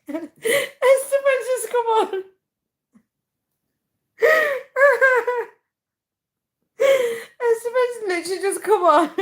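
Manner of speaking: high, squealing through helpless laughter; uncontrollable laughing. Real, not acted. Streamer greets a viewer mid-fit, laughing helplessly. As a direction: barely get the words out for laughing. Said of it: "I can't stop laughing".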